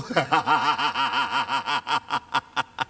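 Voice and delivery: long, rattling belly laugh. Real, not acted. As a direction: laugh on and on at your own joke. Joking with the audience. (tawa panjang terbahak-bahak)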